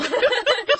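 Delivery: high, loud burst of laughter. Real, not acted. Bursts out laughing at own joke.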